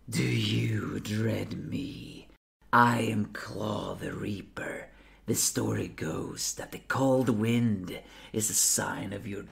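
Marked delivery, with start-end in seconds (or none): none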